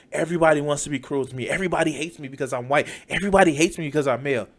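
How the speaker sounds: quick, low, soft imitation of a grievance; mock self-pitying inner voice. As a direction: rattle off someone's private complaint mockingly. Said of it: "They think in the back of their mind".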